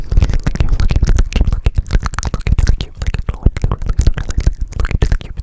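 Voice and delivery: muffled cupped hand whisper; fishbowl whisper. Shown as a demonstration. Fishbowl trigger repeated.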